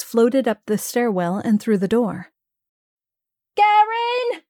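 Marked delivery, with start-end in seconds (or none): 3.6-4.4 very high shrill shriek. Acted. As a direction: shriek his name up the stairs. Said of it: "the innkeeper shrieked".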